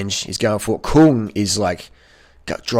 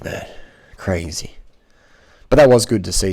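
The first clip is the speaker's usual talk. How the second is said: usual speaking voice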